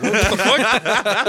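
high, loud, slow disbelief with a laugh; mock outrage; shocked. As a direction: act baffled and caught off guard. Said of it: "I'm like".

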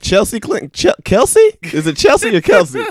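high, loud fumbling name; confused flub. Retold or imitated. Actor botching a name in outtakes, confused high. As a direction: trip over the name, confused. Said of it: "He's like".

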